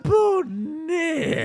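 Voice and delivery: high, slow creaking voice; creaky door voice. Acted. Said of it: "the way a creaky door would say it".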